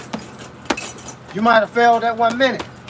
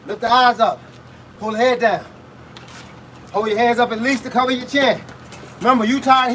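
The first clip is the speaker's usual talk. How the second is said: usual speaking voice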